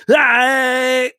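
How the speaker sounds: high, long comic battle cry; mock action-star yell. Ending the pretend video intro, high mock yell. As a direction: let out a silly stretched high yell.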